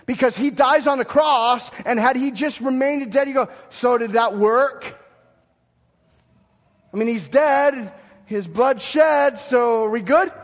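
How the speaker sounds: a preacher in full flight; preaching at full force. Acted. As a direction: build to loud, high pulpit fervour. Preaches the cross and resurrection.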